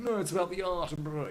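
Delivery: high, loud mock pious artist; mock self-righteous musician; pretentious artist. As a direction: sneer it like a sellout artist. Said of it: "artists are very going".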